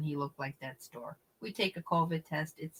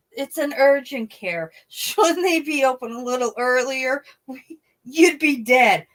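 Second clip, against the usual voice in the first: indignant outburst; 6 semitones higher, 8 dB louder than usual. Retold or imitated. Outraged at closed urgent care.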